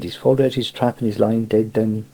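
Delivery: ordinary delivery, nothing marked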